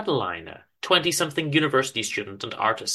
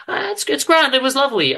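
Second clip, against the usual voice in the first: high, louder pleased wife; wife's voice; 12 semitones higher, 6 dB louder than usual. Acted. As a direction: lift up into the wife's bright praise. Wife praises cathedral.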